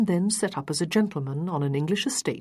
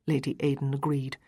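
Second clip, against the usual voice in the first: ordinary delivery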